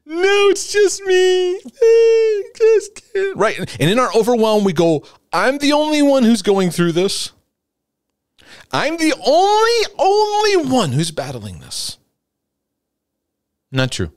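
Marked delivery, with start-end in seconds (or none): 0.1-3.4 high, slow, hammed-up tearful whine; mock whining. Preacher mocks Elijah's self-pity to the congregation: high tearful whine. 5.3-12.0 higher, tearful hammed-up whine; mock self-pity. Preacher imitates overwhelmed believers feeling alone: high mock sobbing.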